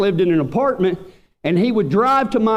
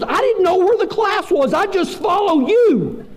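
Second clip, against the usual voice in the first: sheepish excuse; 10 semitones higher than usual. Retold or imitated. Roommate sheepishly explaining his lateness.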